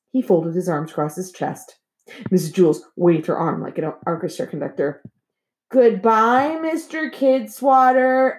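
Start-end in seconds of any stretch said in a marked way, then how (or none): 5.7-8.4 slow sing-song chorus, pitched high; high, drawn-out classroom chorus; children in unison. A narrator's reading. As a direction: stretch it out like a whole class answering together. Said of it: "everyone said together".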